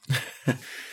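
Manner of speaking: a short airy laugh with no voice; breathy laugh. Real, not acted. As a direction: laugh softly under the breath. Guest laughs at host's question.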